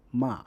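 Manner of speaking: ma in Mandarin fourth tone, sharp fall. Shown as a demonstration. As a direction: drop ma hard and fast. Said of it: "The fourth tone is a sharp, falling tone".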